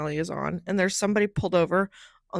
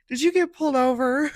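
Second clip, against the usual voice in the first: excited guess; 6 semitones higher, 6 dB louder than usual. Retold or imitated. Bartender guesses her news.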